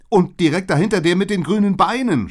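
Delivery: high, very loud cry of alarm; horrified scream. A narrator's reading. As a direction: scream the warning in horror. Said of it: "schrie ich entsetzt". (entsetzt geschrien, hoch und laut)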